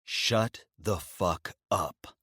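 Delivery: slow, cold and flat contempt. Acted. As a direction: drag each word out icily. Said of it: "Rio says coldly".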